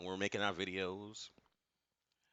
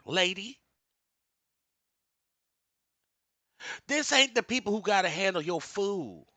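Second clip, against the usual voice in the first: preaching rant; 8 semitones higher than usual. Real, not acted.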